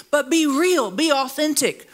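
ordinary delivery

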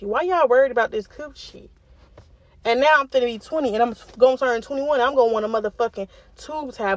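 usual speaking voice

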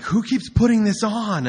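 hammed-up irritated guest; mock annoyed partygoer. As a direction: whine it like a bummed partygoer.

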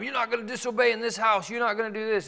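stern parent scolding. Retold or imitated. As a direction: bark it like a strict parent at a disobedient kid. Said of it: "we come down hard on them".

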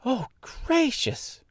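put-on high, drawn-out girlish exclamation; astonished cry. A narrator's reading. Girl learns she faces a witch, astonished. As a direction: gasp it out slowly, very high. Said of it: "cried Dorothy".

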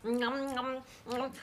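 hedgehog smacking its lips while chewing; greedy nom nom chewing sounds; munching noises. A narrator's reading. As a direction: chomp it up noisily. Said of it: "mit lautem Geschmatze verspeist".

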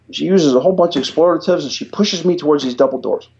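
normal talking voice

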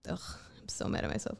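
hushed, breathy whisper of self-blame; muttered to herself. Real, not acted. Alone with her regret, a whispered self-reproach. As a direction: whisper it in frustration at yourself.

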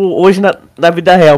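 usual speaking voice

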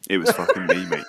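owning up to the cleaner, laughing loudly; laughing confession. Pretend. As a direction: blurt it out while cracking up. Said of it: "been like".

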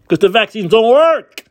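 loud, high, cutting retort; emphatic answer. Real, not acted. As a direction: snap back the punchline.

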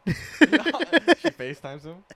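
slow, loud, laughing through it; cracking up while saying it. Real, not acted. Laughing while telling the joke.

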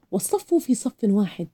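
ordinary delivery

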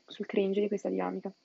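ordinary delivery

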